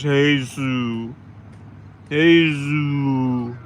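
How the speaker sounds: Jesus said as "hay-ZOO"; Spanish pronunciation of Jesus. Shown as a demonstration. Ranter showing how the name is not pronounced, Spanish-style Jesus. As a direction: stretch Jesus out as hay-zooo, no final s. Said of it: "it's not pronounced Jesus".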